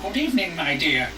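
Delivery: a hint of a sweet old granny; old woman voice. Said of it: "Sorry for the old woman voice".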